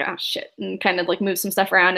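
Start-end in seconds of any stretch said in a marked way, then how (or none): 0.0-0.5 soft, low-key mutter to oneself; muttered curse. Acted. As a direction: mutter it under your breath. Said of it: "mutters a".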